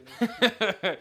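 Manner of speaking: loud, high real laugh; a bright, loud real laugh; burst of laughter. Real, not acted. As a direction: laugh out loud at your own joke. Laughing at dog's good life.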